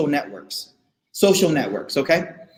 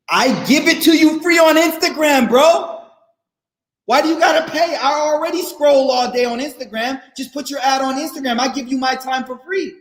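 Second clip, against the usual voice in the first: mock indignant; 4 semitones higher than usual. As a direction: protest like a baffled viewer.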